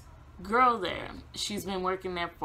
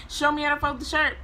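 insistent retort; 8 semitones higher, 4 dB louder, faster than usual. Retold or imitated. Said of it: "And I'm like".

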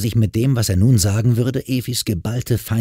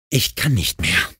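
groaning. A narrator's reading. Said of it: "stöhnte Laila".

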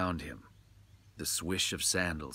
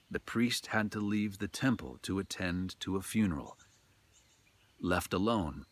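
ordinary delivery, nothing marked

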